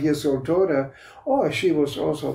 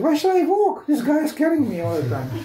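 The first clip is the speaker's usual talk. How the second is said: put-on cheeky toddler voice; 12 semitones higher than usual. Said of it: "She said".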